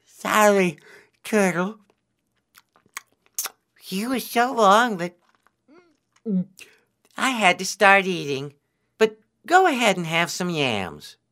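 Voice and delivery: talking through a mouthful; high voice with a mouth full of food. A narrator's reading. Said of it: "with his mouth full".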